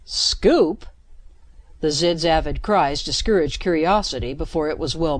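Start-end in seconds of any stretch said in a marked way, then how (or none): 0.0-0.8 puzzled. Acted. Puzzled question in danger.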